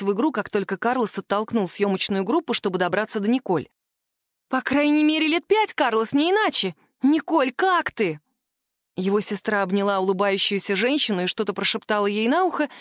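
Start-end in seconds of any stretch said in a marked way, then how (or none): 4.5-8.2 gushing excitement. Acted. Angela plays along, gushing.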